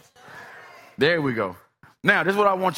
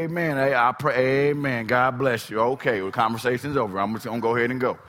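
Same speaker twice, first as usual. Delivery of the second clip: dismissive brush-off; 6 semitones lower than usual. Pretend. Models politely ending an argument.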